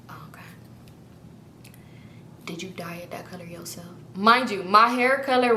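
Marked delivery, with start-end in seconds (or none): 0.1-3.8 very soft, low whispered chat; imitated seatmate whispering; retold whisper. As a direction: keep the question hushed for a funeral. Chatty seatmate during the funeral.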